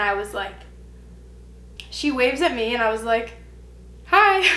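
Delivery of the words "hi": high startled little hi; awkward hi. Retold or imitated. Greeting the girl who walked in, high surprised hi. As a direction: squeak out a surprised hi.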